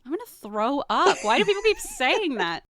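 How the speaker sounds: high-pitched mock disgust; mock nauseated. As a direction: squeal it like you're about to be sick. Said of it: "I'd be like".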